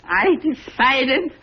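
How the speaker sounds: high, slow excited announcement; telling cousin he will go along. Acted. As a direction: tell him, bursting with joy.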